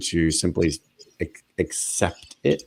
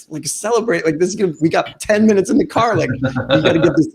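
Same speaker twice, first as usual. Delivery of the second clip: much higher and louder, disbelieving; mock exasperated disbelief to Mike; incredulous; 10 semitones higher, 4 dB louder than usual. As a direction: protest that there's no time to celebrate. Incredulous protest retold to Mike.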